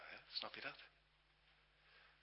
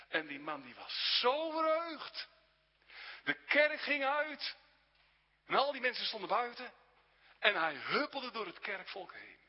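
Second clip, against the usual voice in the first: higher and louder, swept up in the joy; rising excitement; 10 semitones higher, 8 dB louder than usual. A narrator's reading. Preacher telling the congregation of the man's joy, excited. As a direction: tell it like good news you can hardly hold in.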